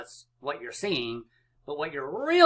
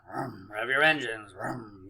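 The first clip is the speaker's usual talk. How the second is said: low, playful vroom noise; engine revving; 4 semitones lower than usual. Pretend. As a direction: rev like a race car on the start line. Mimics revving engines before a game.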